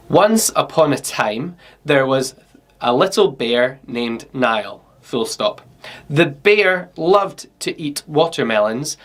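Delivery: slow, careful dictation with the punctuation spoken; dictating slowly. Shown as a demonstration. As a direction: speak each phrase clearly for the microphone. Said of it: "now I'm gonna use the dictate tool to record my story".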